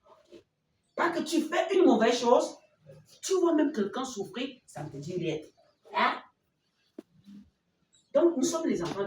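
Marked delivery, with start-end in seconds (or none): 1.0-4.5 voix indignée. Acted. Reproche indigné aux enfants.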